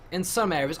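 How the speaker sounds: normal talking voice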